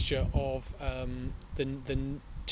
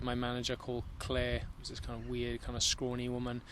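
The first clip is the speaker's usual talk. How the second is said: ordinary talk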